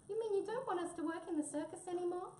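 high-pitched, quavering little mouse. A narrator's reading. Mouse asks headman if they are sacked, quavering. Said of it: "quavered poor Mouse".

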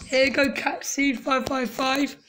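normal talking voice

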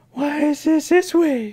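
high, drawn-out whine; hammed-up bewildered girl; mock confused girl. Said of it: "she's confused and like".

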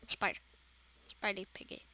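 hushed whisper of the name; nervous whisper. Real, not acted. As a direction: whisper it, scared of being heard. Hiding from the monster.

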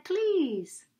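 sweet polite please. Shown as a demonstration. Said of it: "He used his manners".

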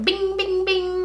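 high, ringing "bing"; dazzle sound effect. Shown as a demonstration. Vlogger mimicking a blinding highlight, high and ringing. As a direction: sing out each bing like a sparkle. Imitates an overly flashy highlight.

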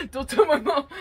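joking plea through giggles; laughing. Real, not acted. Joking confession to listeners about snacking, laughing. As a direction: let laughter break up the words.

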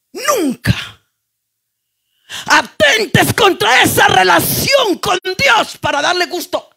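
shouted high preaching, every phrase hammered out; full-force preaching. Acted. Preacher calling her church to look at Abraham, shouting. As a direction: thunder it at the congregation like a revival preacher.

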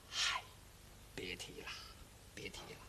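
put-on faint whispered groan of a beaten old man; weak whisper. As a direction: barely breathe the words out in pain. 挨打老人虚弱说话. (挨打老人虚弱地低声说)

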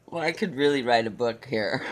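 ordinary talk

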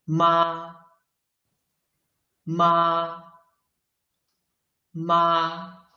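"Ma" and "妈" drawn out high and level; first tone. Shown as a demonstration. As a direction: sustain "Ma" and "妈" on one high pitch. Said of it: "Put them together".

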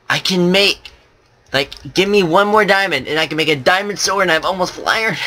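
high, eager gamer excitement; excitedly planning the sword. Real, not acted. Excited about crafting a diamond sword.

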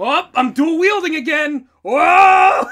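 high, loud laughing yell; real gleeful shout; excited shout. Real, not acted. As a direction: whoop at the top of the lungs.

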